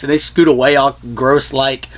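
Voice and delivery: ordinary talk, nothing marked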